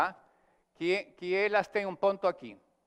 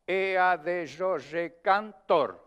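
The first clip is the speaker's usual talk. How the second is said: slow, stressed verse, each syllable counted out; scanned metre demo; 3 dB louder, much slower than usual. Shown as a demonstration. Teacher showing students where the seventh-syllable stress falls, slow and measured. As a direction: tap out the seven syllables slowly. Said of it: "é a sétima".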